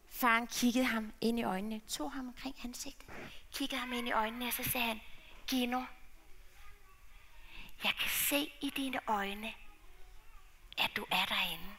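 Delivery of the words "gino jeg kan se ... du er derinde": hushed unvoiced tender words; father whispering to son. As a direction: whisper it close to his face.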